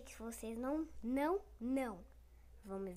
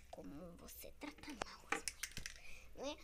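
whisper; 6 semitones lower, 8 dB quieter than usual. Pretend. Whispered scolding.

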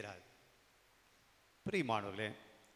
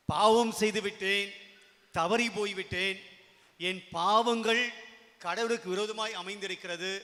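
loud, emphatic cry of a sinner; anguished confession; 4 semitones higher, 8 dB louder than usual. Acted. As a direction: proclaim the confession at full preaching force. (உரத்த குரலில் பாவ அறிக்கை)

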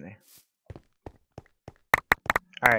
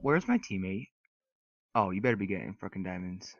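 usual speaking voice

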